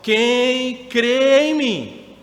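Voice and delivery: very slow, drawn-out call to the crowd; crying out. Acted. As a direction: stretch every word as a public cry. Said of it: "e ele clama em alta voz".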